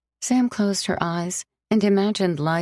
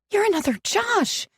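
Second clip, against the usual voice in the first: high, cracking accusation; wounded, spit it out; bitter verdict. Acted. Sam telling Alex he is another Josh, wounded.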